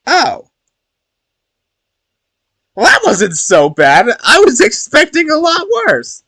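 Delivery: very high, loud relief; relieved high exclaim. Real, not acted. Streamer reacting to the period reveal, high relieved squeal.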